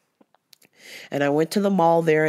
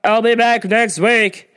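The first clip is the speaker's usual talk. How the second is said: loud, demanding customer call; imitating the pushy shopper demanding service, loud; entitled customer; 3 semitones higher, 6 dB louder, slower than usual. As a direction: snap for attention like you're owed it. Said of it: "she was like".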